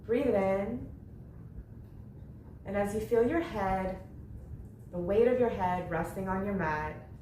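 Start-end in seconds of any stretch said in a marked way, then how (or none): none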